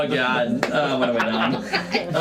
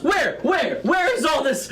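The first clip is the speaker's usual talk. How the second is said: high, exasperated demand; angry; 7 semitones higher than usual. Acted. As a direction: play an indignant rant, pitched up. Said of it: "Orak is mad at you".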